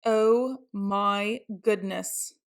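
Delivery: ordinary delivery, nothing marked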